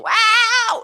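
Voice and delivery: loud, high, pushed scream; uncontrolled scream. Shown as a demonstration. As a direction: yell it out with no control. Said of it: "as soon as you start to get really loud ... without control".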